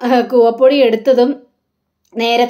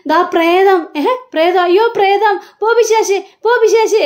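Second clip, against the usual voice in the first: higher, hammed-up terrified shouting; comic mock terror, rising higher; panicked ghost cries; 6 semitones higher than usual. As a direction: shoo the ghost away in panic. Shouting at a powder-covered ghost.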